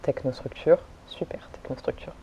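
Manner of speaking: soft unvoiced whisper. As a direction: breathe the name out in a whisper. Whispered shout-out.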